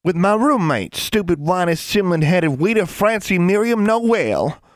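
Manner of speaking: put-on high, indignant little-girl rant; high-pitched country-child tirade. A narrator's reading. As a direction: jeer at a rival in a high voice. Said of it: "Innocence raised her voice".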